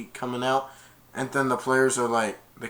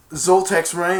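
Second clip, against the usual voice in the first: ordinary talk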